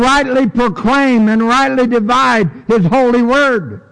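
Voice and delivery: high, building preacher's cry; rising preaching fervor. Acted. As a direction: lift the congregation with each line. Preacher builds to full force.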